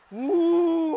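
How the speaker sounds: loud, high hammed-up whoop; playful cry of awe at the glowing drawer; mock wonder. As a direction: whoop like a miracle just happened. Mock miracle light from drawer.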